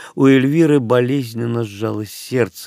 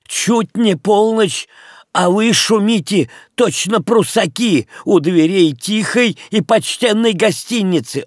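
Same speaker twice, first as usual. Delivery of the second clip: выше и медленнее, возмущённо отчитывая; бранит ночных гостей через дверь, повысив голос; сердитый крик хозяина; 9 semitones higher, 4 dB louder than usual. Acted. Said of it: "кричал сквозь дверь хозяин". (хозяин гостиницы кричит на ночных гостей, возмущённо)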